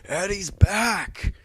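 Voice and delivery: loud, thrilled fan cheer, stretched out slowly; excited cheer. Retold or imitated. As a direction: celebrate like your favourite hero just came back. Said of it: "I'm like".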